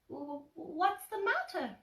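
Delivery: high, stammering piglet voice; tiny worried piglet; nervous Piglet. Acted. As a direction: stutter it out, a little nervous.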